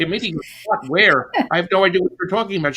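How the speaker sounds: high, hurried protest; baffled. Retold or imitated. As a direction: act out bewildered denial, speeding up. Baffled reply to screaming friend.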